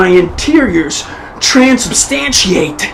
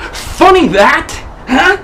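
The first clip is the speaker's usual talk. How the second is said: mocking taunt; 7 semitones higher, slower than usual. Taunting the hostage master.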